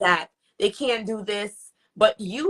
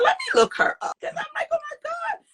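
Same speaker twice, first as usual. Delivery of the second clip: high-pitched acted "oh my God"; retold amazement; faster than usual. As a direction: squeak out the astonishment, far above usual pitch. Retells astonished discovery.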